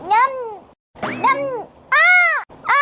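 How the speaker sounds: munching sounds then an ouch; chewing then pained cries. Acted. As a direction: chew hard, then cry out in pain. Mother bites the hard vada. (மென்று பின் வலியில் அலறல்)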